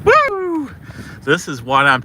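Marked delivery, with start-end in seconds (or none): 0.0-0.7 laugh, then a high whooping cheer; giddy laugh and whoop. Real, not acted. Vlogger cheering the rare snowfall, laugh then high woo. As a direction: whoop with delight at the snow.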